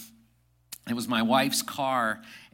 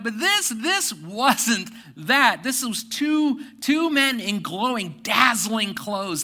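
awed; 8 semitones higher, 8 dB louder, slower than usual. Real, not acted. Describing the dazzling angels.

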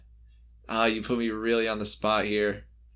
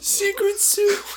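laughing; much slower than usual. Real, not acted. Cracking up repeating phrase.

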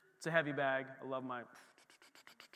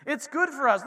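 1 louder repeat; 5 semitones higher, 7 dB louder than usual. Shown as a demonstration. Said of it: "Because what?".

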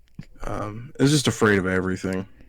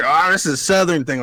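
fake Southern accent; 6 semitones higher, 5 dB louder than usual. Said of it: "puts on a fake accent".

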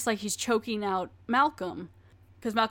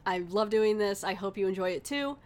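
ordinary delivery

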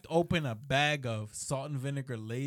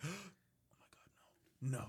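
breathless whispered oh my God, slowly drawn out; whispered mock alarm; 8 dB quieter, slower than usual. To his furious mom, a hushed gasping fake panic.

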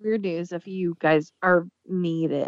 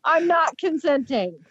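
high protest; 8 semitones higher than usual. Pretend. Protesting the Wolverine voice.

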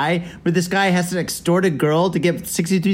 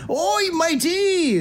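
high, drawn-out mock British pirate cry; bad British accent; 12 semitones higher, much slower than usual. Shown as a demonstration. Host mocking his own British accent as pirate-like, bad British accent. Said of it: "a British accent. I sound like a pirate".

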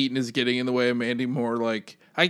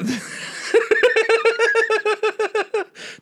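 real giggling burst; losing it with laughter; high laughing fit. Real, not acted. Cracking up at the reading.

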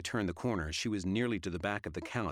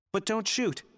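panicked shout; 14 semitones higher, 6 dB louder than usual. Acted. Panicked plea.

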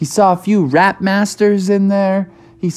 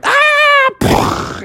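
very high mock scream; short, high cartoon scream; falling scream. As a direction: shriek as you crash into the room. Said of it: "people were like".

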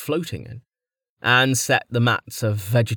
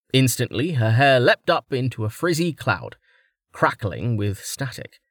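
usual speaking voice

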